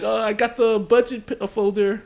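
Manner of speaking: high, imitated second grader; little kid. Little Vince explaining himself to the teacher, imitated kid voice. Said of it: "little li-, Vince".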